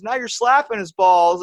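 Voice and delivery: high, loud, giddy announcing; mock hysterical report. Friend narrates the ghost prank, high giddy shout. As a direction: blurt it out half-laughing, hammed-up.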